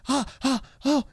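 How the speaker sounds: high, breathless little ahs of delight; imitating her amazed discovery; a girl suddenly understanding, gasping with wonder; excited gasps of wonder. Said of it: "she's like".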